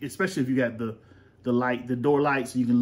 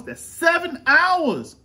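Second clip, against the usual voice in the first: mock outrage; 4 dB louder, slower than usual. Mock outrage at school hours.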